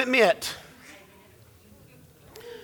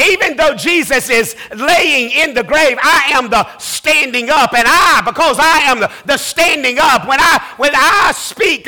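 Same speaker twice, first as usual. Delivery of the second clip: full preaching; 3 semitones higher, 3 dB louder than usual. Acted. Pastor rousing his congregation about Lazarus, building preaching. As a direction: preach it, climbing toward a shout.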